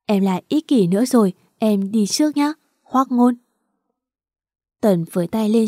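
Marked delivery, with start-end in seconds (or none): none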